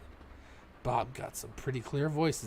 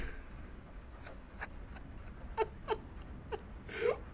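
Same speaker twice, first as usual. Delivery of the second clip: squealing breathless laughter, near tears; high wheezing laughing fit; 6 dB quieter than usual. Real, not acted. Player losing it over the game's woods line, tearful laugh. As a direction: lose it completely, high and gasping.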